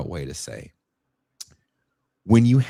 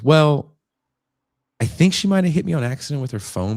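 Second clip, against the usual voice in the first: put-on high, quick earnest kid voice; kid answering; 9 semitones higher, 5 dB louder, faster than usual. Boy tells the guard why he hit mom, high and fast. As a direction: let it tumble out fast and high.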